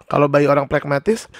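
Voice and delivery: normal talking voice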